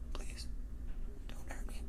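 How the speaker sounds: breathy whispered plea, almost silent; pleading whisper. Retold or imitated. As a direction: beg softly in a whisper. Begging the attacker.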